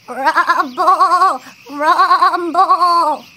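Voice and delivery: wobbly, drawn-out rumble; playful, loud quivering rumble; imitated rumbling. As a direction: rumble it like shaking ground. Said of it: "I bet it sounded like this".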